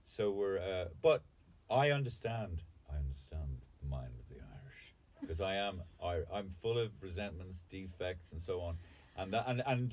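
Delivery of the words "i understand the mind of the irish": mock solemn. Mock grave declaration.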